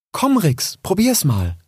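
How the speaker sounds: high, gentle coaxing mother; mother fox voice. Acted.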